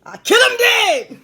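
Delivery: loud, high, drawn-out yell; big comic shout. Acted. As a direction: belt it out like a battle cry. Boast about fighting everyone.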